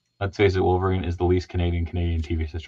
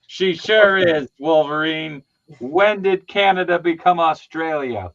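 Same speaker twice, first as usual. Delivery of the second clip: mock sarcastic; 8 semitones higher, 4 dB louder than usual. Mocking reply to a silly mix-up.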